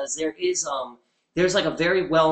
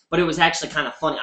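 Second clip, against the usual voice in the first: usual speaking voice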